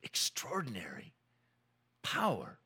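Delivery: whispered, hushed with wonder; awed whisper. Acted. Preacher marvelling at the power of words, whispered. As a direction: breathe the word like a secret.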